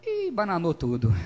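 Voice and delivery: ordinary talk, nothing marked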